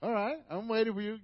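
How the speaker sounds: ordinary talk, nothing marked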